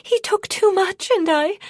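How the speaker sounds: very high, loud, frantic explanation; desperate, high-pitched protest; feverish pleading. A narrator's reading. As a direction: gabble your excuse in panic until cut off. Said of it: "Palila said feverishly".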